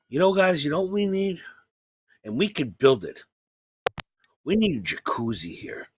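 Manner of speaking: low, quiet, conspiratorial grandpa; a hushed grandfather pitching a jacuzzi. Retold or imitated. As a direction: murmur it like a sly old man with a plan.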